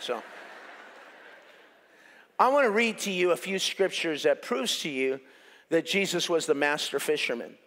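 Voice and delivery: normal talking voice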